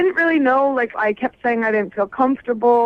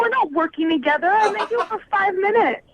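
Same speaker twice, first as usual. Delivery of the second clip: real outraged disbelief, pitched way up; indignant protest; 10 semitones higher than usual. Real, not acted. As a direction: push back hard, voice climbing high. Confronting Chase who claims working relationship.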